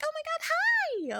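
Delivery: very high, squealing excited greeting; super excited. Shown as a demonstration. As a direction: shoot the voice way up in delight. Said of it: "I get super, like, excited, where I'm like".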